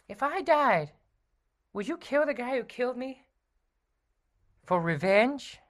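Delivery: high, bright sidekick voice; Robin voice. Acted. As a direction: play the eager young sidekick.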